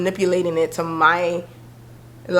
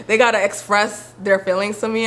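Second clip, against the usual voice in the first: usual speaking voice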